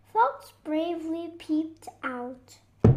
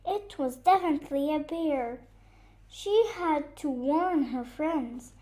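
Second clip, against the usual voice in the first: usual speaking voice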